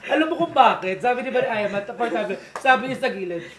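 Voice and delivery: loud, high laughing talk; laughing loudly. Real, not acted. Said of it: "gusto na ako tumawa ng malakas".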